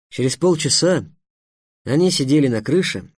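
ordinary delivery

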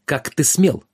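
mock-stern indignant cry; angry exclaim. A narrator's reading. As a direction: demand it like an offended master. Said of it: "Воскликнул". (возмущённо, с укором)

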